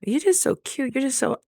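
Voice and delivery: high, cooing voice gushing cute; playful high mimic of admirers; mock cooing compliment. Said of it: "I'm getting so many compliments".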